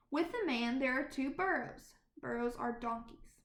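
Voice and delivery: ordinary delivery, nothing marked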